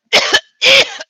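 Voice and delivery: very loud, forceful hacking coughs; imitated cough. Showing a shopper how the man coughed on vegetables, loud imitated coughs. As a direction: re-enact his coughing at full blast. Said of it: "He did just like this".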